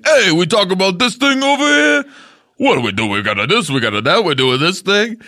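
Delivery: high, rambling goofy voice; goofy other guy voice. Retold or imitated. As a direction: play a clueless rambling guy. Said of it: "suddenly being another guy".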